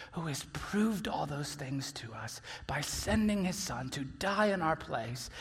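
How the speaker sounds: low, soft and measured; hushed preaching. Real, not acted. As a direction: bring it down low and earnest, with pauses.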